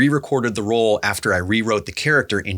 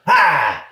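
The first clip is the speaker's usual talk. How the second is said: short loud undead roar; zombie growl; 6 semitones higher, 5 dB louder than usual. Acted. Actor records zombie sounds for the director, loud zombie roar. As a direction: bark it out like a lunging zombie.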